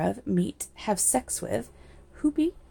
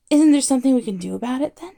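anxious rush; 5 semitones higher, 8 dB louder, faster than usual. Acted. Barman pleads about world ending.

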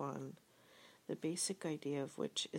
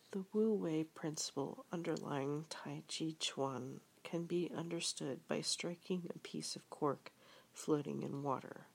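plain reading voice

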